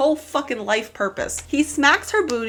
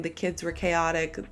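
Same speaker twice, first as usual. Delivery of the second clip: usual speaking voice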